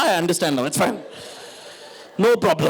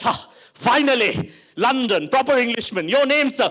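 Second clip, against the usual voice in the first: put-on relieved, over-proper British-Indian accent, rising in pitch; delighted posh accent; 2 semitones higher than usual. Posh friend meeting proper Englishman, delighted posh accent. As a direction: savour it in an affected posh accent.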